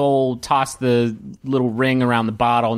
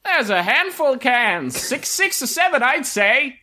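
high-pitched sales patter; enticing game vendor; 9 semitones higher than usual. Acted. Vendor urging a player to try, high coaxing patter. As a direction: coax them in like a fairground hawker. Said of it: "one particularly enticing vendor who's".

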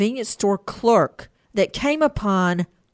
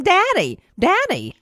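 put-on much higher, little-child voice calling out; imitating a toddler; 10 semitones higher, 3 dB louder than usual. Host imitating the toddler on the 911 call, high childlike voice. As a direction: mimic the small child calling for her father. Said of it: "You hear the two-year-old going".

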